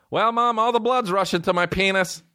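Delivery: put-on high-pitched, proud-sounding little-kid call; imitated kid announcing. Cousin walks through kitchen telling mom, high mock-kid announcement.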